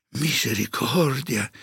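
high, slow cry of dismay; shocked exclamation. A narrator's reading. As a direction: exclaim in drawn-out horror. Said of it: "esclamò Vittoria". (esclamazione sgomenta, acuta e lenta)

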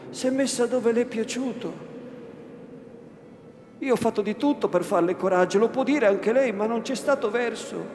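put-on defensive old woman. Old woman excuses herself to her master, high and defensive. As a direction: protest your innocence, fussing.

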